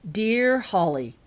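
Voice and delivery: letter reading. A narrator's reading. Laurel begins a letter to Holly, slow and deliberate. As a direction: read out the opening, slowly.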